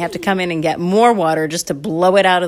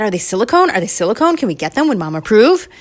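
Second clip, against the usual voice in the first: higher, eager questions; a touch of kid excitement, lifting each question; eager child; 5 semitones higher, 5 dB louder than usual. Acted. Candy asks Dad about the straws, eagerly pleading.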